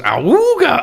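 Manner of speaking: high, honking awooga; ahooga horn. Retold or imitated. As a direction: sound like an old car horn. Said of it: "horns that goes".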